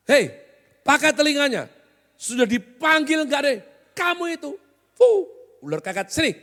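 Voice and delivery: hammed-up bossy scolding, loud and high; demeaning summons. Boss summoning an ignored worker, demeaning scolding call. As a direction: call out rudely as if nobody is listening. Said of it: "Cara manggilnya itu loh, ngerendahin banget".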